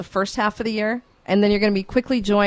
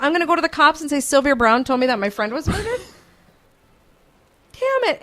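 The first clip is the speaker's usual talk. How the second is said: much higher, incredulous and laughing; exasperated; 7 semitones higher, 3 dB louder than usual. Real, not acted. Host asking friend how to report a psychic tip, exasperated. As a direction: throw up your hands at the absurdity.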